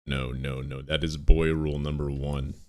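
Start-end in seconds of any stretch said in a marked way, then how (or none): none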